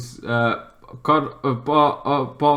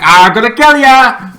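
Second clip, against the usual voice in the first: higher and louder snarl of a threat; furious threat; 8 semitones higher, 10 dB louder than usual. Retold or imitated. Waller threatening her own squad, loud angry threat. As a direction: threaten them at full volume.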